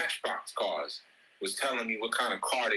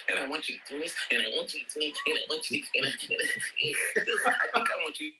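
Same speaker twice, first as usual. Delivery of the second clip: put-on whiny little boy; 3 semitones higher than usual. Husband whining demands at his woman like a little boy. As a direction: whine like a needy little boy. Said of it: "you sound like a whiny little boy".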